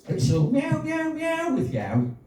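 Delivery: high, drawn-out meows; cat meowing. Acted. Poet voicing a Brummy cat to her boyfriend, high meowing. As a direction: meow it out high, then drop for the tag. Performs a short cat poem.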